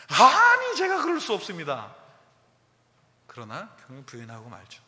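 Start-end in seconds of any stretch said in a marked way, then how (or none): 0.1-1.9 much louder, higher denial; indignant protest. Retold or imitated. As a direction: protest hotly, I would never. Peter protesting to Jesus.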